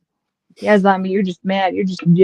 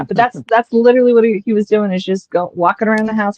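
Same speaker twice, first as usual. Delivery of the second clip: normal talking voice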